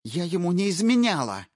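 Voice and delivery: high, loud, furious denial; spat out. Acted. Wife furiously denying to the intruder, spat out. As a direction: spit the last word at him. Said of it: "практически выплюнула".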